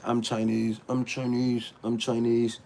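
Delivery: ordinary talk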